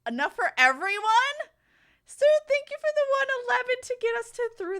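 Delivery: loud high hype; excited shout. Real, not acted. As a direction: cheer the donor on. Hype train reaching level.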